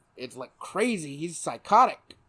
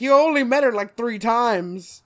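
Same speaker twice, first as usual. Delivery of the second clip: higher, louder incredulous complaint, slowing at the end; exasperated protest; 6 semitones higher, 7 dB louder than usual. Retold or imitated. Viewer scolding Batman over the romance, exasperated. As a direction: protest loudly, dragging the last words.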